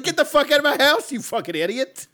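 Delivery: high, loud, fast mock yell; hammed-up furious shout; angry outburst. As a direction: throw him out at full volume. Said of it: "I'd be like".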